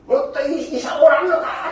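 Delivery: mock yell. Preacher imitating a dying man's complaint, laughing mock yell. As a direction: play a dying man demanding a better doctor, half-laughing. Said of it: "って叫んでもいい".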